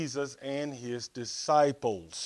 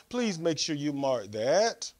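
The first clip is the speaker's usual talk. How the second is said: normal talking voice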